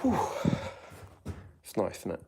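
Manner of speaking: normal talking voice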